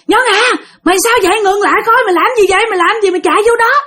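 calling out. Retold or imitated. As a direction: act out calling after a runaway friend. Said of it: "kêu".

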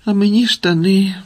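put-on mild boyish sorrow; sad. A narrator's reading. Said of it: "сумно сказав Грицик".

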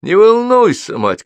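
high, loud call to mother; shouting. A narrator's reading. Son to worried mother on the balcony, shouting. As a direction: call up loudly, pitch leaping high. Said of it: "кричу я".

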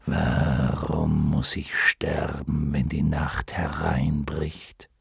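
low, slow, whispered lion. Acted. Lion asks why it dies.

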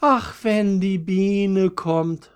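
high, slow, wistful sigh; a violet sighing for the bee, drawn out high. A narrator's reading. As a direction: breathe it out with longing. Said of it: "seufzte das zweite Veilchen".

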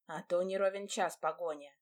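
ordinary delivery

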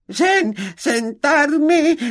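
a house-elf breaking into sobs; wailing elf. A narrator's reading. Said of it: "gimió".

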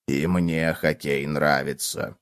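low, slow, soft cockroach; deep-pitched quiet plodding voice; cockroach chiming in. A narrator's reading. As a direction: join in, low and unhurried. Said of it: "оживился таракан".

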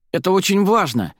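high, urgent insistence. A narrator's reading. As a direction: insist as you hurry after someone. Pleading while chasing her.

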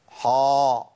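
isolated «حا» with a dark, backed long vowel; heavy ḥ error. Shown as a demonstration. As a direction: hold a fat «حا». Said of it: "حا دي مش موجودة في القرآن". («حا» مفخمة ممدودة)

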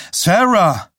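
SARAH shouted high, falling at the end; urgent call. A narrator's reading. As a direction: call out SARAH loudly after her. Calls again, now more urgently. (SARAH laut und dringend gerufen)

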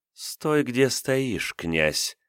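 high, smirking menacing villain; sinister villain menace. Acted. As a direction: threaten him with a sly smirk. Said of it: "зловеще ухмыльнулась злодейка".

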